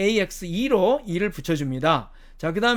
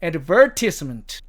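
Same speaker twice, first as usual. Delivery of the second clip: advertisement with stress on the second syllable, VER; British-style stress on VER in advertisement; shifted stress; 4 dB louder, much slower than usual. Shown as a demonstration. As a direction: say ad-VER-tisement, drawn out slowly. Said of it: "이렇게 액센트가 다르게 찍힌다는".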